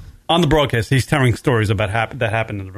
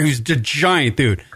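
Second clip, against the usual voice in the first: ordinary delivery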